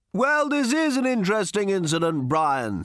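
high-pitched cricket commentator voice; radio commentator. A narrator's reading. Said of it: "said one radio commentator to another".

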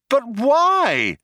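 very high, insistent cry; demanding. A narrator's reading. Trillian questions the cops' threat, demanding cry. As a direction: demand an answer at high pitch. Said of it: "demanded Trillian".

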